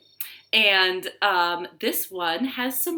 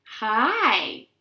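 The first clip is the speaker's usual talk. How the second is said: a lightly voiced bubbly bug, a touch louder; bubbling spittlebug; 5 semitones higher, 6 dB louder than usual. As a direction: say hi like a little bug bubbling in froth. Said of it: "bubbled a spittlebug".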